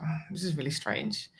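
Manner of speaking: hushed, retold inner thought; puzzled aside. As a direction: say it softly, as if to yourself.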